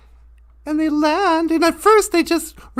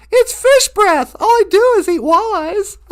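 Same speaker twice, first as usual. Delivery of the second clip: louder, higher mock complaint; comic exclamation; 4 semitones higher, 7 dB louder than usual. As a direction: blurt it out for a laugh, punching each word. Eagle jokes about his breath.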